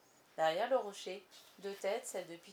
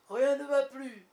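loud, high cry of despair; despairing outburst; 5 semitones higher, 12 dB louder, slower than usual. A narrator's reading. Captain bursting out to his men, despairing cry. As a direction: cry out as if all is lost. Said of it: "laissa éclater son désespoir".

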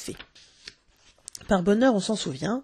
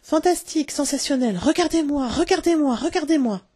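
much higher, gleeful cries; overjoyed shouting; 7 semitones higher than usual. A narrator's reading. Children flying round the bedroom, crying out with glee. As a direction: squeal it like a child in flight.

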